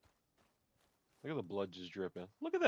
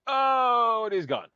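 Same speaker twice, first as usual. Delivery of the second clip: shouted, much higher "Oh"; loud exclaim; 18 dB louder, much slower than usual. Real, not acted. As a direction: yell it out in shock. Reacting to the kill.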